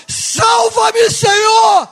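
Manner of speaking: high, sustained full shout for rescue; grito de clamor. Acted. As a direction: belt out the plea, holding the last word. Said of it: "nós vamos gritar". (clamor gritado a plenos pulmões)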